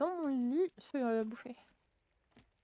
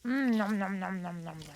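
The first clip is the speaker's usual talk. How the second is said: nom nom chomping; 5 semitones lower, slower than usual. Pretend. Eating food in game.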